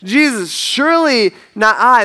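high, loud protest of innocence; deeply distressed. Retold or imitated. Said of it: "And deeply distressed".

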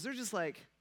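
ordinary delivery

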